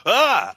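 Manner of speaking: sudden high yelp of fright, acted; mock startled yelp. Retelling how Zach jumped, a startled yelp. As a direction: squeak like a spooked man. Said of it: "He was like".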